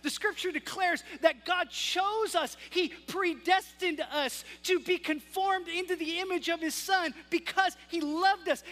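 building pulpit proclamation; full-force preaching. Acted. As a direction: preach it out to the congregation.

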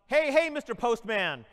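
loud slow call to the mailman; mock yell across the snow. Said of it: "She yelled".

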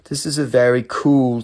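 normal talking voice

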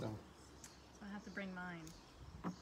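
soft, barely audible remark; soft aside. Real, not acted. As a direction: say it quietly, almost to yourself. Not heard, listener asks "What?.

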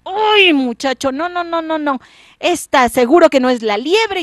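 high, loud protesting king; indignant king. Acted. The king protests to the boy, high and loud. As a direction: throw up your hands and protest.

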